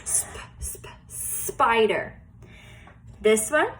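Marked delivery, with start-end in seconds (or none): 0.0-2.2 whispered "Sp, sp", then "spider" whispered; whispered sounding out. Shown as a demonstration. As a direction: whisper "spider" as if breathing on paper. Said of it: "breathe it on the paper".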